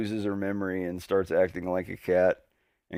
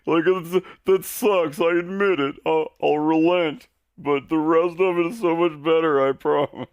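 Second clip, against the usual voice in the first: high, loud pleading fan voice; mock the eager fan begging him to keep watching; pushy anime fan; 11 semitones higher, 7 dB louder than usual. Mocking friends who insist the anime gets better, high and pleading. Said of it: "every single person that tells me".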